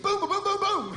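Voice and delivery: Basil Brush catchphrase. Retold or imitated.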